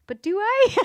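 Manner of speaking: high, loud questioning retort; mock innocent doubt. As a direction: challenge it with a squeaky-high question. Mock questioning of own partner's criticism.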